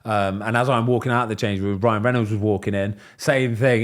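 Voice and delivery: ordinary talk, nothing marked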